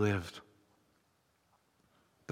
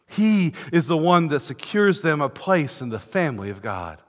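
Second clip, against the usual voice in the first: ordinary talk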